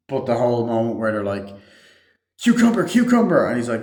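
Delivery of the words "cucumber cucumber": high, loud retold cry; mock shouting. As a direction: shout it in imitation, pitched right up. Acting out characters shouting a word.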